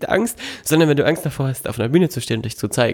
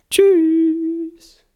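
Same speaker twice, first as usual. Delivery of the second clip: sing-song high bye; high-pitched goodbye; 3 dB louder than usual. Acted. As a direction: squeal a drawn-out goodbye.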